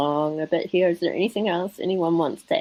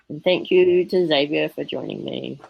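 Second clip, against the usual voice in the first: ordinary talk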